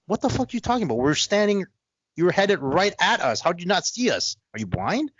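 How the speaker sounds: high, exasperated "are you blind"; mock outrage at a friend who ignores you; incredulous retort. Retold confrontation with friend.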